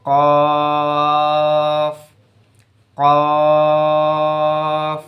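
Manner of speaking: heavy qaf demo; thick Arabic qaf. Shown as a demonstration. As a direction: say কফ thick and full. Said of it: "একটু মোটা করে উচ্চারণ হবে".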